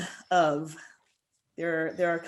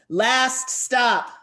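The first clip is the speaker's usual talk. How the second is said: loud, higher call of the stop; conductor yell; 6 semitones higher, 7 dB louder than usual. Acted. Conductor announcing the end of the line, a loud high call. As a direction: shout it so the whole train wakes. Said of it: "The conductor yells again".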